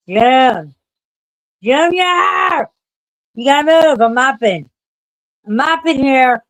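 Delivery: yelling at the cat to move; calling out loud. Real, not acted. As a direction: shout the name to get him moving.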